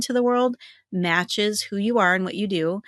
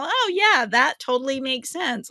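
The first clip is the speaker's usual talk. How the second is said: impressed client; 6 semitones higher than usual. Retold or imitated. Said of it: "they go".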